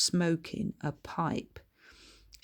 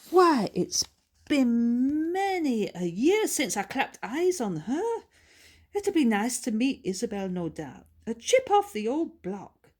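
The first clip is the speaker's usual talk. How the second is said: put-on high, brisk old Scottish lady; chatty Scots auntie, pitched well up; higher, louder old lady puzzling aloud; Bertha's voice; 7 semitones higher, 4 dB louder than usual. Bertha reminiscing.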